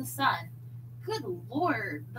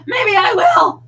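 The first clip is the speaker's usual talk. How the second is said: much higher, very loud defiant yell; furious yell; 12 semitones higher, 19 dB louder than usual. A narrator's reading. Wife yelling back at husband, furious shout. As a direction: scream it in defiance. Couple's escalating quarrel.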